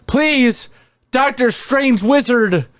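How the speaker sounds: high, whiny begging; whiny plea. Retold or imitated. Peter whining to Doctor Strange, high and pleading. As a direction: beg like a teen whining.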